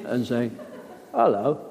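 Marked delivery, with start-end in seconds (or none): 1.1-1.6 laughing mock camera greeting; Michael Caine direct address. Actor mocks direct address to camera, laughing. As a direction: turn to the lens and greet, half laughing. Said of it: "turning like Michael Caine".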